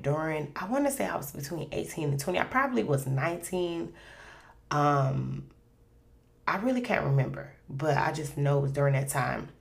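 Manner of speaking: ordinary talk, nothing marked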